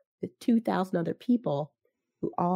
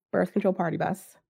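ordinary delivery

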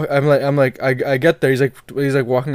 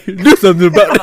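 laughing mock taunt; 6 semitones higher, 7 dB louder than usual. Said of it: "You barely opened your left eye".

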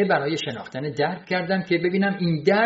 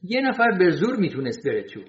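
ordinary delivery, nothing marked

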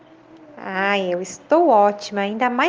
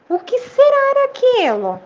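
puzzled wonder; 12 semitones higher, 3 dB louder than usual. A narrator's reading. Boy puzzled by the echo.

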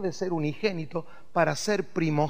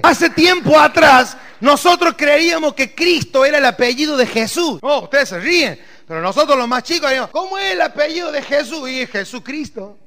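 preaching; 7 semitones higher, 11 dB louder than usual. Acted. Pastor preaches at full force.

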